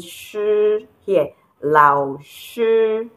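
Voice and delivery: "Shi" held long on a level pitch; flat tone on Shi. Shown as a demonstration. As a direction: keep "Shi" level and drawn out. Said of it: "This is the flat tone".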